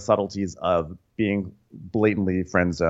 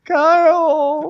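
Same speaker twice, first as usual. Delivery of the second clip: squeaky echo; 5 dB louder than usual. Retold or imitated. Echoing a squeaky quote.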